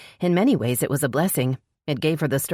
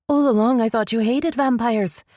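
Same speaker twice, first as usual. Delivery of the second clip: put-on higher young woman's voice, blurting it out; Esrel, young woman; 9 semitones higher, 3 dB louder than usual. A narrator's reading. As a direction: let it slip out, higher and startled.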